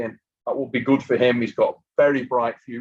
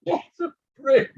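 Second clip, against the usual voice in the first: laughing; 4 dB louder, much slower than usual. Real, not acted. Laughs at friend's teasing.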